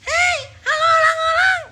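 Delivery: loud, very high mock call; mocking squeaky voice. Host mimics a would-be leader hailing people, shrill mocking shout. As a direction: shriek a greeting in ridicule. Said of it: "Nggak ada orang pemimpin suaranya".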